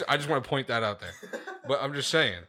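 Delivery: a loud laugh, then fast laughing talk; chuckling at his own insult. Real, not acted. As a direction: laughs it off and hurries on. Laughs after calling someone a pussy.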